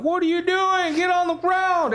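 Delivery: high, shouted confused command; police yelling. Retold or imitated. Officers yelling at the driver climbing into the back seat, high shout. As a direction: yell at him like a startled cop. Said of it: "and they're like".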